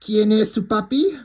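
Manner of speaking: a hammed-up Spanish come-on; Spanish line. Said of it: "He's like".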